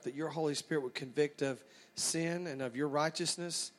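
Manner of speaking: low, soft prayer voice; opening prayer. Real, not acted. Preacher praying to God before the message, low and reverent.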